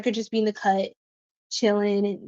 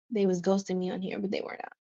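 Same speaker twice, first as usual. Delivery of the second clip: under the breath, much softer, voiceless; whispered aside; 3 semitones lower, 10 dB quieter, faster than usual. Real, not acted. Aside about ghosting in chat.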